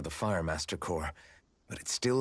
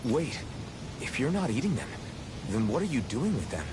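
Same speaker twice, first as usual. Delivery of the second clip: surprised; 3 semitones higher than usual. Acted. Startled by odd answer.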